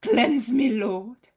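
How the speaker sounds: loud, impassioned begging; fervent plea. A narrator's reading. Leper begging Jesus to cleanse her, loud and fervent. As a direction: plead with all your soul.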